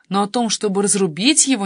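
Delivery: ordinary delivery, nothing marked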